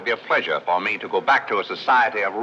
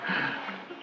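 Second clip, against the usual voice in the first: short, breathy quiet laugh; soft chuckle; 10 dB quieter than usual. Acted. Man amused by her remark, soft chuckle. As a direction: let out a hushed chuckle.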